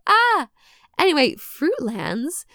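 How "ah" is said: embarrassed yelp. Real, not acted. Flustered at own pronunciation.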